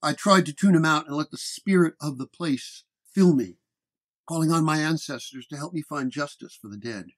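usual speaking voice

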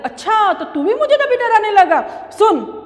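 high, indignant "so you too?"; a mouse feigning shock at being bullied; mock indignation. Mouse to embroiderer, high and indignant.

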